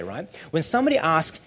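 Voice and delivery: normal talking voice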